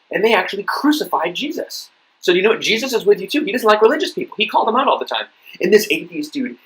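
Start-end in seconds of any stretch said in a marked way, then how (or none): 0.1-5.2 higher, animated persuasion; excitedly making the case; eager retold pitch. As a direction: win the listener over with rising enthusiasm. Retelling his eager pitch to atheist.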